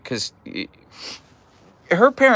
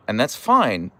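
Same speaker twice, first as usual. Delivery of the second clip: normal talking voice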